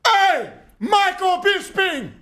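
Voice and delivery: put-on very slow, high Cuban-accented holler; stretched-out mock declaration in Cuban accent; Yoel Romero. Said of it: "turns to him and goes".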